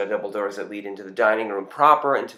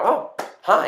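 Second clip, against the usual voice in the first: startled greeting, much higher; a sudden high, flustered hello; surprised hi; 12 semitones higher, 5 dB louder than usual. Retold or imitated. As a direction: caught off guard, pitched up high. Caught off guard by producers.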